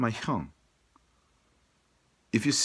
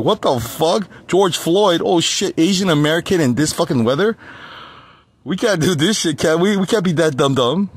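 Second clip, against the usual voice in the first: mocking officials; 6 semitones higher, 8 dB louder than usual. Mocking cops and paramedics.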